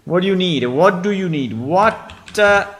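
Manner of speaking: loud, high call. Acted. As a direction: shout it across the room. Calling out to trade partner.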